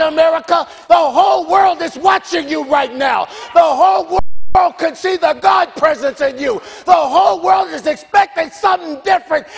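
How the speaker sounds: loud, high preacher's shout; full-force preaching. Acted. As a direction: lift the congregation with every line.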